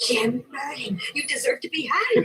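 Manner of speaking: whisper. Acted. Scolding whisper to partner.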